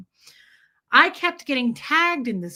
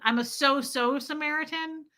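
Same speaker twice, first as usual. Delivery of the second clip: ordinary talk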